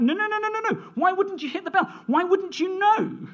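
put-on high, eager child's protest; excited child. Father imitating son Toby's high, eager protest. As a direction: mimic a boy begging to know. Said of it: "He was like".

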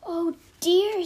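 high, loud little gasp of dismay; dainty dismay. A narrator's reading. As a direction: sound prim and shocked. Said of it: "said Matilda".

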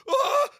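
sudden high, loud "Ah!"; demonstrated gasp. Shown as a demonstration. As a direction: let out a sharp high cry to show it. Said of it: "go like this".

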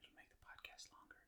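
very soft, voiceless whisper. Real, not acted. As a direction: whisper so nobody overhears. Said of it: "Why are we whispering?".

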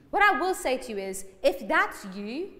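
ordinary talk

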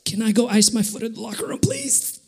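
high, rushed, tearful plea; squeaky plea, voice rising at the end; trying not to cry. Retold or imitated. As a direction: hold back mock tears while asking. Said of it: "'Cause I'm trying not to cry".

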